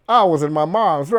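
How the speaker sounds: slow, drawn-out Southern drawl; Southern accent. Shown as a demonstration. Demonstrating the funny Southern accent to viewers, dragged Southern drawl. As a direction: drag the words in a Southern accent. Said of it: "accent ya South".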